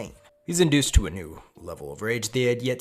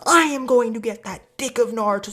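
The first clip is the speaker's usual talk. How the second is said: put-on high, loud, fierce girl voice; mock anime girl yelling at full tilt; yandere outburst; 13 semitones higher, 9 dB louder than usual. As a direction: shriek a possessive battle declaration. Acting yandere girl yelling at enemies.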